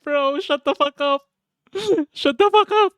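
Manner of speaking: laughing through a high, mock-scolding rebuke. Real, not acted. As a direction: scold him while cracking up. Laughing at brother's bad Japanese.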